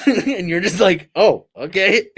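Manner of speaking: high, loud, slowed "oh, okay" through laughter; stunned, laughing. Retold or imitated. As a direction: sound caught off guard and amused. Stunned reaction to cold laugh.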